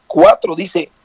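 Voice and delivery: ordinary talk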